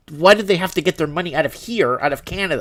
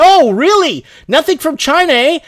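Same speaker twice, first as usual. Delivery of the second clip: higher and louder, a mock "oh really" scoff; sarcastic disbelief; 10 semitones higher, 5 dB louder than usual. Real, not acted. Host scoffing at the news report, sarcastic mock surprise. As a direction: act surprised but make it plain you don't buy it.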